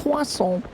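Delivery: a mock French croissant, kwah-son; croissant en français, kwah-son. Shown as a demonstration. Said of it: "You mean croissant?".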